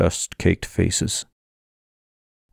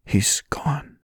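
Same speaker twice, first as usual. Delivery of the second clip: hushed, breath-only whisper; grieving whisper; 7 semitones higher, 5 dB quieter than usual. A narrator's reading. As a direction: whisper the news of a death, softly. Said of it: "Then he whispered".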